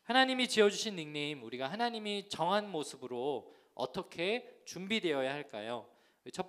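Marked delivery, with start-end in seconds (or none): none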